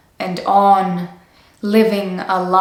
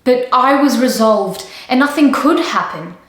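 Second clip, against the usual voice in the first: higher, louder, insistent self-justification; emphatic protest; 5 semitones higher, 4 dB louder than usual. Acted. Woman confessing feelings for her sister's husband, emphatic protest. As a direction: protest it, pitch jumping up. Insisting affair was impossible.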